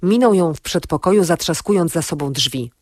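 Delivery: plain reading voice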